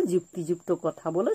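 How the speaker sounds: usual speaking voice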